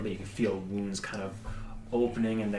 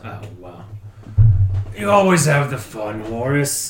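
mocking dwarf voice; 8 semitones higher, 14 dB louder than usual.

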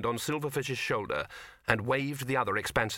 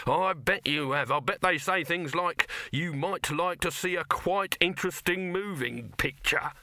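higher, animated salesman voice; excited mockery; 6 semitones higher than usual. A narrator's reading. Dibbler scoffing at Silverfish's posters, raised and animated. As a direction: rise into a mocking quote. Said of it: "said Dibbler excitedly".

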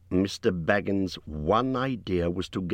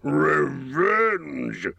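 higher, scornful dragon snort; 6 semitones higher, 3 dB louder than usual. A narrator's reading. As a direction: spit the word out with contempt. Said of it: "he snorted".

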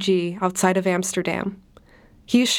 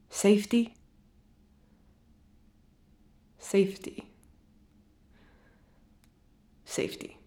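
each word softer, sinking almost to nothing; fading repetition; 6 dB quieter than usual. Acted. Refugee telling therapist of his new safety, fading away. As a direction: let it die away into a near whisper. Refugee listing what he now has.

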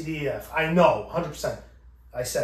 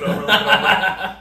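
laughing; 5 semitones higher, 9 dB louder than usual. Real, not acted. Laughing while acting out.

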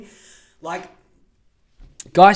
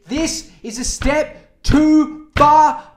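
high, loud and slow, hammed-up fury; outraged yell; 8 semitones higher, 10 dB louder, slower than usual. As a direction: bellow it like the last straw. Ranting, outrage building.

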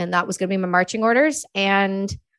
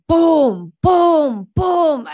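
loud, punchy booms, rising in pitch; imitated gun booms; 7 semitones higher, 5 dB louder, much slower than usual. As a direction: bang out each boom like artillery fire. Imitating artillery firing fast.

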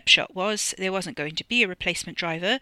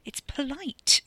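plain reading voice

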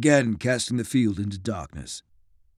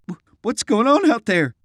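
shaky voice; 9 semitones higher, 3 dB louder than usual. Acted. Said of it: "his voice shaking".

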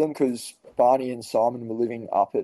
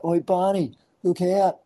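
higher-pitched shout-to-a-mate, not full volume; retold call-out; 7 semitones higher, slower than usual. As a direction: call out a warning to a friend. Calling out to friend before throw.

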